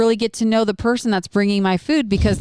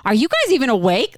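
higher-pitched, incredulous scolding question; mock scolding; 6 semitones higher, 3 dB louder than usual. Imitating a host to her silent audience, scolding. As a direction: scold a silent crowd, pitch climbing at the end. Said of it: "Like, scolded us".

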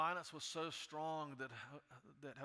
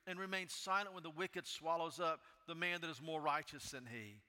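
loud, high impassioned preaching; preaching at full force; 5 semitones higher, 5 dB louder than usual. Acted. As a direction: cry it out to God with full fervor. Preaching second complaint at full force.

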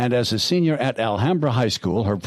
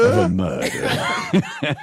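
slow, laughing dramatic repeat; 4 semitones lower, much slower than usual. Real, not acted. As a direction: say it again through laughter. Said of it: "How was that again?".

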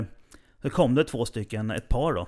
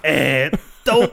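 generat avsked; 9 dB louder than usual. Retold or imitated. Awkward retreat after being caught.

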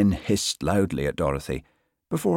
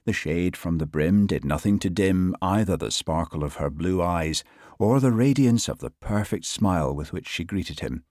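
plain reading voice

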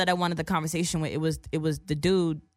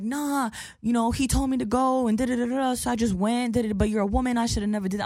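higher, mocking dude voice; mimicked excuse-making; 5 semitones higher than usual. Dude explains himself to the woman, mimicked excuses. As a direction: imitate his rambling excuses, pitched up.

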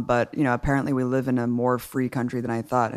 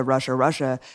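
usual speaking voice

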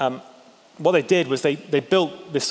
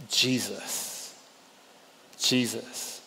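hushed; 4 semitones lower, 9 dB quieter, much slower than usual. Shown as a demonstration. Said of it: "revealing Jesus".

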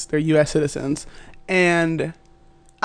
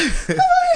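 wheezy laugh. Retold or imitated.

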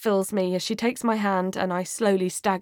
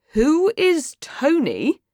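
insistent, high, drawn-out question; louder; 8 semitones higher, 4 dB louder, slower than usual. Acted. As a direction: repeat it slowly with raised pitch, demanding. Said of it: "asks again, louder".

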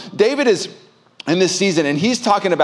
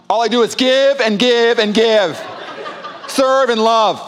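mock self-pity; 6 semitones higher, slower than usual. Retelling his resentment, laughing.

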